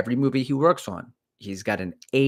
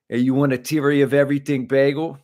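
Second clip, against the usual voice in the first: louder, higher mock Jersey accent; 5 semitones higher, 6 dB louder than usual. Imitating coworker offering a bagel, Jersey accent. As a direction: put on a Jersey bagel-seller voice. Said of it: "not even close to Jersey".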